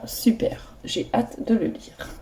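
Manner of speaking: soft eager whisper, slowed. Real, not acted. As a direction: whisper it like a little secret to the viewers. Teasing a book not yet read. (chuchoté, tout doux et impatient)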